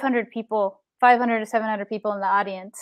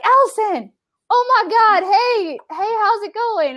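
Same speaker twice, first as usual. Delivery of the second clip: high, excited squeal of hello; excited greeting; 11 semitones higher, slower than usual. Retold or imitated. Players spot the girl at camp, high excited hello. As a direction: gush over her like an old friend.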